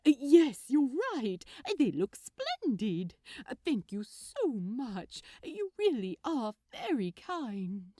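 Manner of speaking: loud, beaming gentleman; delighted Aziraphale. Acted. Gentleman thanking his friend, loud and beaming. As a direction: burst with gratitude.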